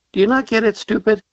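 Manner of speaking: high, mock-impatient cop calling him stupid; retelling the cop's exasperated, high-pitched scolding.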